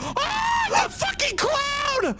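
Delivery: real terrified scream, very high and slow; scream at the clown. Real, not acted. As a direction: shriek in genuine fright as the clown appears. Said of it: "what the fuck you screaming for".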